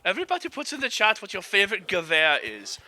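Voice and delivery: high put-on voice for the chat. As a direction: speak to chat in a silly high voice. Streamer addressing chat in voice.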